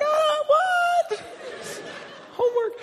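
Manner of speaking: mock traumatized squeaky voice; voice up high. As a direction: ask it in a comic falsetto. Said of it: "my voice went up that high".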